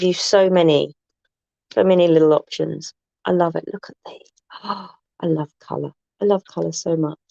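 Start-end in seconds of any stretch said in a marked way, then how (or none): 3.7-4.3 breathy delighted whisper over the book; hushed gasp of delight. Real, not acted. Excited whisper over colour book. 4.6-5.9 gasp, then whisper I love colour. Real, not acted. Whispered, then repeated aloud.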